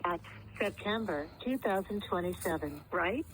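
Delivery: recorded automated phone voice; phone menu voice. Real, not acted. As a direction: read it like an IVR system. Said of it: "transferred over to voice line".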